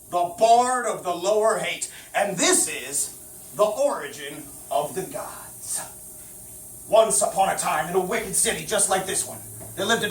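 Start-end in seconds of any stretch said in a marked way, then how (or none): 0.1-5.8 low, slow, dramatic reveal; deep portentous storyteller intro; ominous announcer. Acted.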